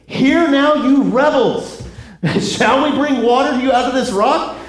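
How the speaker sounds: a fed-up prophet barking at the crowd; exasperated Moses. Retold or imitated. Moses scolding the rebels.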